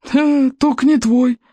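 put-on high, slow, creaking old man; creaky sneer. A narrator's reading. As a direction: creak it out with scorn. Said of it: "проскрипел библиотекарь". (проскрипеть высоко и медленно, с издёвкой)

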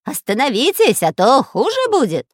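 loud, high threatening villain voice; Дырка's creepy shout. A narrator's reading. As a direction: threaten loudly as a villainous spy. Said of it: "кричал жутким голосом шпион Дырка". (жутким голосом, угрожая)

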